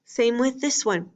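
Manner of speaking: usual speaking voice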